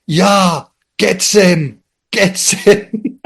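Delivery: retold whooping yell, not full force; mock scream. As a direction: imitate an excited cheer shouted from a car. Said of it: "screaming into the night".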